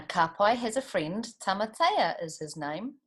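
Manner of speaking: usual speaking voice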